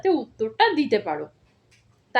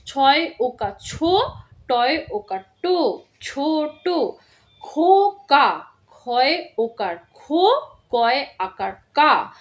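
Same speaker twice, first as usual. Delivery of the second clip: slow spelling out; 3 dB louder, slower than usual. Shown as a demonstration. Teacher spelling out a word slowly for first graders. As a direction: spell each letter slowly and clearly. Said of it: "আরেকবার বলে দিচ্ছি কিভাবে পড়তে হবে".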